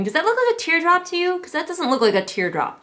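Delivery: high, fast disbelief; incredulous. Real, not acted. Reviewer asking viewers about the map, high and disbelieving. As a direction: ask it baffled, voice jumping up.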